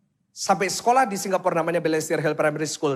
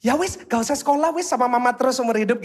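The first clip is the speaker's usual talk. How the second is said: higher, put-on parent voice; mock indulgent parent; 5 semitones higher than usual. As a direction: coddle the child in jest. Acts an over-indulgent parent.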